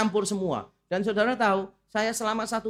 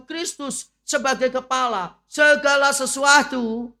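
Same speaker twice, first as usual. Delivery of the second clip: loud, emphatic sermon delivery; full-force preaching; 7 semitones higher, 7 dB louder than usual. Acted. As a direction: proclaim it to the whole congregation.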